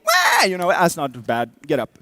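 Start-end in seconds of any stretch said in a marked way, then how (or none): 0.0-0.4 a short, much higher, loud mock baby "Wah"; imitated toddler wail. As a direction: mimic a toddler's quick cry. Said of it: "if they immediately go".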